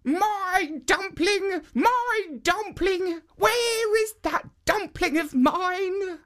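put-on high, slow calling old woman; high slow granny calling; old woman crying out. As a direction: call after the lost dumpling like a fretful granny. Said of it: "still crying out".

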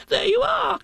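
very high, loud squeak; vindicated Piglet. A narrator's reading. Piglet vindicated before Kanga, triumphant squeak. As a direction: crow it in a tiny squeal.

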